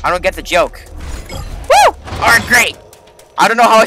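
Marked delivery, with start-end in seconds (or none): 1.3-1.9 very high falsetto woo; a quick high whoop; high-pitched whoop. Real, not acted. Gamer escaping a tricky part, high whoop.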